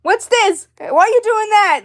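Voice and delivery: very high, loud, piercing questions; annoying niece, voice that goes right through you; Shelby. Shown as a demonstration. Annoying niece doll asking questions, loud voice. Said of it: "Make her have a loud, loud voice".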